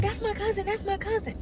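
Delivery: lightly voiced nervous girl; frightened girl. Girl explains at window.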